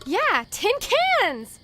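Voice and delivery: thrilled at a find of junk, high and loud; delighted. Acted. Spots trash to collect, delighted.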